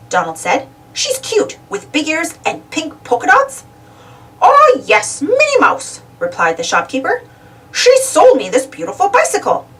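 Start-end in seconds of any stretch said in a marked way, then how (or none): 0.9-3.6 higher, lightly voiced duck. A narrator's reading. Donald describing Minnie to the shopkeeper, lightly voiced. 4.4-6.0 high, louder character voice; bright recognition from the shopkeeper. A narrator's reading. Shopkeeper answering Donald about Minnie, high character voice. 7.7-9.7 higher shopkeeper character. A narrator's reading. Shopkeeper telling Donald about the bicycle, higher voice.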